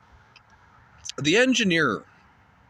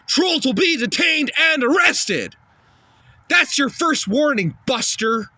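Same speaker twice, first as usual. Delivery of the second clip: mock police shout; 5 semitones higher than usual. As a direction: yell warnings like a cartoon cop. Troll police act, shouting warnings.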